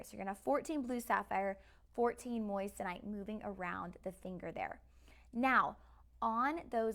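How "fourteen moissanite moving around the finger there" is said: ordinary talk, nothing marked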